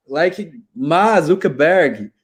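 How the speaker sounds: very slow "Ma Zuckerberg" with no K at the end of Mark; Maltese-style swallowed K. Retold or imitated. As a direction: drawl "Ma Zuckerberg" and drop the K. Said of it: "ele meio que comia o K". ("Ma Zuckerberg" lento, sem o K)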